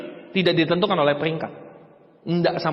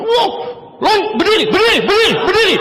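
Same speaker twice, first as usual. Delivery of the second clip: high, loud hype shouting; mock yelling MC; 8 semitones higher, 4 dB louder than usual. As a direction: bellow at the crowd to stand up. MC yelling at audience to stand.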